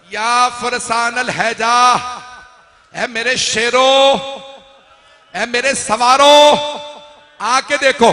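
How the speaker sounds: loud, high, slow summons; calling out. A narrator's reading. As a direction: call the riders to come and see. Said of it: "मौला ने आवाज दी". (ऊँची आवाज़ में पुकारना)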